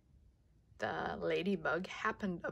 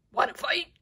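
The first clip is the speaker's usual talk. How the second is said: grouchy ladybug voice; 11 semitones higher, 4 dB louder, faster than usual. Acted. Ladybug challenges elephant.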